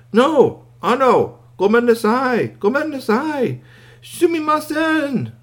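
very high, loud, pleading cries; frantic squeaky toy. A narrator's reading. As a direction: burst out in panicked apology. Upset toy breaks into Japanese.

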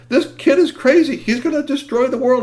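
high, pressing plea to the chief; urgent plea. Acted. Gods begging Zeus to stop the boy, urgent and high. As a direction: beg for help, alarmed.

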